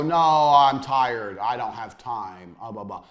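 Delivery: slow, drawn-out lazy whine that fades away; mock a tired friend dodging work; lazy excuses. Mocking lazy friends who won't work, slow fading whine. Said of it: "they all sit there and go".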